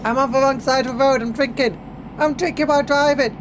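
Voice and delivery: high, loud, fast alarm; excited panic. Real, not acted. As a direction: blurt it out in a flustered rush. Panicking while driving.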